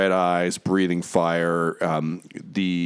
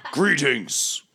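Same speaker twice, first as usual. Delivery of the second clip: booming voice; 4 semitones higher, slower than usual. Acted. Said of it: "a booming voice".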